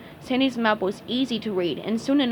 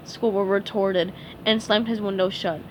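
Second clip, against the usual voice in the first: plain reading voice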